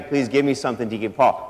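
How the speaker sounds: a hint of a pleading beggar, low and soft; lightly voiced beggar. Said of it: "He's begging".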